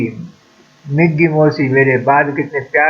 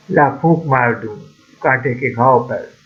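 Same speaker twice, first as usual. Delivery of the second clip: usual speaking voice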